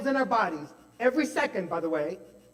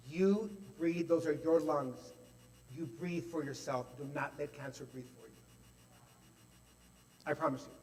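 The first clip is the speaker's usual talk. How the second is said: soft gentle voice; 3 semitones lower, 7 dB quieter than usual. Retold or imitated. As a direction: say it softly and kindly. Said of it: "it was so gentle".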